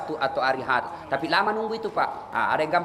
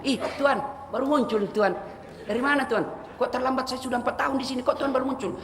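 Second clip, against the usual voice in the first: higher-pitched puzzled questioning; acted surprised maid; 8 semitones higher than usual. Maid Inam asks late master why he only now appears, surprised. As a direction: lift the voice in mock surprise.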